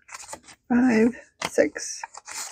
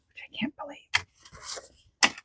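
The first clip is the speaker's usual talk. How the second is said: hushed, unvoiced whisper over the savings; 6 semitones higher, 13 dB quieter, much slower than usual. Real, not acted. Saver whispering her savings total to viewers, in awe. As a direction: breathe it out like a secret. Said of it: "I don't know why I whispered that".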